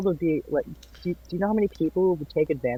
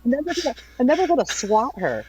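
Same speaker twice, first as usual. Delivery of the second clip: mock threat; 10 semitones higher, 4 dB louder than usual. Imagined Baltimore people threatening.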